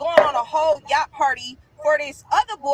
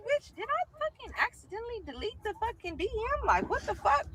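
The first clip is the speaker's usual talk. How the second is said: much softer, higher, stunned disbelief under her breath; panicked self-talk; 5 semitones higher, 8 dB quieter than usual. Retold or imitated. Scolding herself for deleting the DM, soft high alarm. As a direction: hiss it to yourself in alarm.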